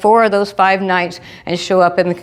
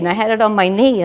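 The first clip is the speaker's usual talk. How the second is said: ordinary talk, nothing marked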